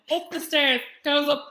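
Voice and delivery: usual speaking voice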